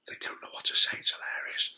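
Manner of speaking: amused whispered aside; whispering. Real, not acted. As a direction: whisper it with a grin.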